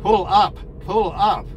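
flat robot voice imitation; monotone cockpit warning robot voice. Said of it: "in the robot voice".

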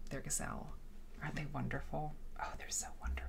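very soft, fading to a whisper; hushed admiration. Real, not acted. Streamer admiring the quilt's gazelles, hushed. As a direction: marvel quietly, almost to oneself.

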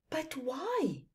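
high, small puzzled voice; puzzled calf. Acted. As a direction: ask it like a confused little calf. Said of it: "asked the puzzled calf".

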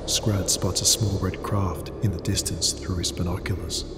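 ordinary delivery, nothing marked

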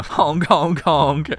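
playful honking noise; silly clown honk. Acted. Clown honks.

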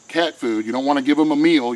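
normal talking voice

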